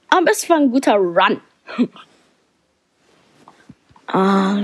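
ordinary talk

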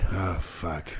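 ordinary talk

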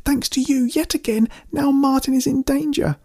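high-pitched, bitter shriek; very high, distressed accusation; accusing shriek. A narrator's reading. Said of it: "Amanda shrieked".

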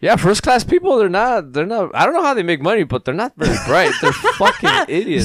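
high, loud, laughing as he mocks them; amused rant about clueless first class passengers. Real, not acted. Laughing rant about rich flyers.

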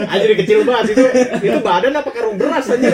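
laughing hard mid-sentence. Real, not acted. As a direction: crack up while talking. Laughing at weight joke.

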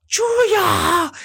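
very high, loud imitated shriek of a name; mock high-pitched yell; excited calling out. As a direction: squeal the name across the aisle like a fan. Imitates lady calling out in store.

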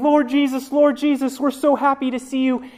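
high, bright eager calling; eager greeting. Pretend. Crowd greeting Jesus, high and eager. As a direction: greet him expecting welcome.

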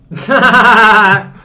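high, loud cackling burst; loud laughter. Real, not acted. Joker after boasting about women, loud laughter. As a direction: crack up at your own boast.

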